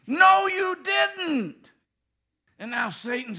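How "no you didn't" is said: high, slow denial; higher, louder mock husband; protesting husband. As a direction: push back hard and slow. Husband arguing back.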